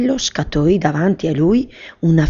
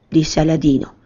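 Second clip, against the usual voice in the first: usual speaking voice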